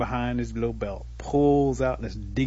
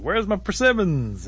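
high, loud, drawn-out complaint; acted impatient shout up a tree; demanding call; 9 semitones higher, 6 dB louder, slower than usual. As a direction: holler up for your missing share. Turtle demands food from below.

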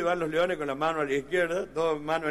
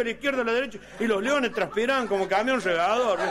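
ordinary talk

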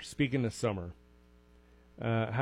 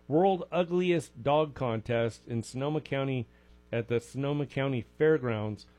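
ordinary talk, nothing marked

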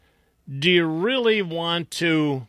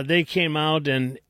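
ordinary talk, nothing marked

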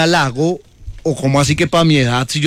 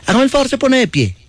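higher mock doctor voice; mock doctor giving orders, higher; imitating doctor; 8 semitones higher than usual. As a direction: play the bossy doctor, pitched up.

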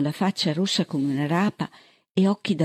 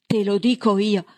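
higher, louder shout; 6 semitones higher, 4 dB louder than usual. A narrator's reading. Bird losing his temper with the deputy, shouting. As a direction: let it burst out as a yell. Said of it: "Ormai stava gridando".

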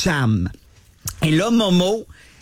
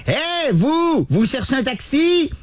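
high calling out to a stranger; imitated call; 11 semitones higher, slower than usual. Biker calls out to the lost host. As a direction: call out across the street, pitched high. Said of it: "il y a un gars qui me crie".

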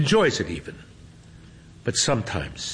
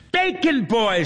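high, bright excited greeting; excited exclaim; 14 semitones higher, 4 dB louder than usual. A narrator's reading. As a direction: call out his name thrilled. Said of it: "she exclaims".

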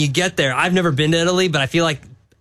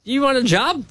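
higher-pitched calling-out voice; imitating a casual job offer; 5 semitones higher than usual. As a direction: call out a breezy mock offer. Voicing coach casually offering a job.

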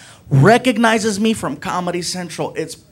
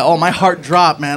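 ordinary delivery

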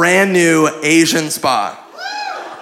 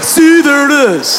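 smug triumph; 9 semitones higher, 3 dB louder than usual. Retold or imitated. Buddy triumphant about spa sign.

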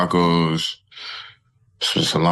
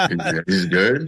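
laughing; 9 semitones higher, slower than usual. Real, not acted. Dodging the quarterback question.

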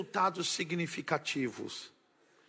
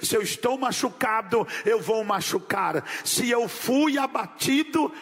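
rising, rhythmic preacher's cadence; fervent pulpit preaching, half sung; 3 semitones higher, 3 dB louder than usual. Acted. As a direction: build each line like a call to the congregation. Preacher's rhythmic call and response.

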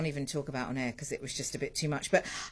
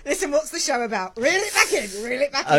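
loud; 7 semitones higher, 8 dB louder than usual. Real, not acted. Host steering guest back.

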